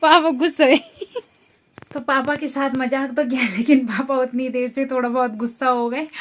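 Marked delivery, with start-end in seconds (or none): 0.0-0.8 laughing. Real, not acted. Laughing, papa is angry.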